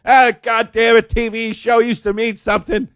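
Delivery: high mock maid shouting her grievance; griping maid. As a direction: rant like a disgruntled maid. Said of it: "There's no maids walking around going".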